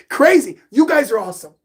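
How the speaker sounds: high laugh into "Crazy". Real, not acted. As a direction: laugh it off, amused. Laughs at own pronunciation.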